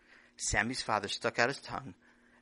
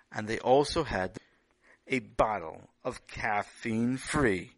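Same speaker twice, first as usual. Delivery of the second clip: storytelling narration; 2 semitones higher, 10 dB louder than usual. A narrator's reading. Loud food narration.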